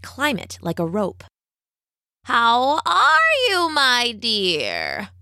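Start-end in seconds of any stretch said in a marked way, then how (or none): 2.2-5.1 high, slow witch voice swooping down; old witch. A narrator's reading. Witch greets her captive Rapunzel, slow and witchy. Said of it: "the witch would ask".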